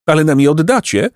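high-pitched, wary pelican question; suspicious. A narrator's reading. Pelican doubting the fish will be returned, suspicious. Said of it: "pytał podejrzliwie pelikan".

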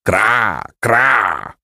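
drawn-out imitated croaking caw; two long raven croaks; raven's caw. A narrator's reading. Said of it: "Nie kracz tak strasznie".

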